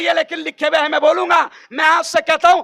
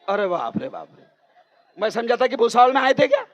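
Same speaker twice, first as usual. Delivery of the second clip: laughing; 4 semitones lower, 4 dB quieter, faster than usual. Real, not acted. Mocking the crowd's empty accounts.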